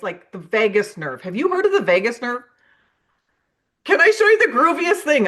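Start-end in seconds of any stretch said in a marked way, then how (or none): 3.8-5.3 hyped groovy pitch. Shown as a demonstration. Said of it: "I would never use the word grooviest".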